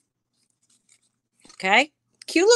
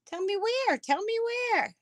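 much higher, sing-song begging; playful pleading; 9 semitones higher, 5 dB quieter than usual. Real, not acted. Seller begging a viewer where the butterfly is, high and pleading. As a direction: coax the answer out, teasing.

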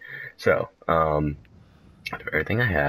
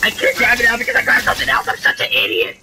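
frantic; 6 dB louder, faster than usual. Real, not acted. Panicking in inventory.

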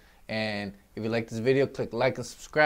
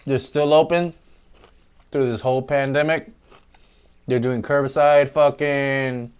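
speaking through fingers; hand over mouth; 6 dB louder than usual. Real, not acted. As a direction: chin resting on hand. Seen 4: Hand rests on chin, fingers touching lips, partly covering mouth.

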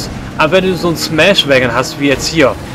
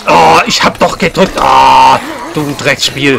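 loud, annoyed yell at the game; frustrated outburst; 5 dB louder, slower than usual. Real, not acted. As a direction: snap at the game.

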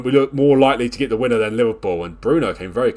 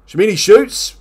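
excited call; 10 semitones higher, 8 dB louder than usual. Real, not acted. Calling a shot in play-by-play.

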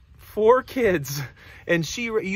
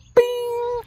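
high ringing "bing"; sudden held high ding; idea chime. Acted. Reader imitates piggy's idea arriving, high chime.

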